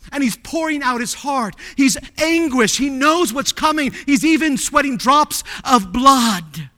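high, loud sermon fervour; impassioned preaching. Real, not acted. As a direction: preach it with mounting force. Preaches Jesus' anguish at full force.

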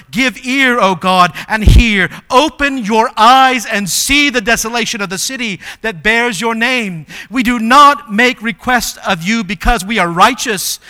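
loud, emphatic preaching plea to God; impassioned prayer. Acted. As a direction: cry out a bold scripture prayer.

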